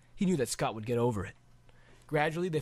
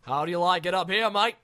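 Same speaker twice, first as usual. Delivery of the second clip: louder, hearty dragon giving instructions; big dragon guiding the rider, louder; friendly dragon coaching; 6 semitones higher, 6 dB louder than usual. Acted. Dragon coaching the boy before takeoff, hearty.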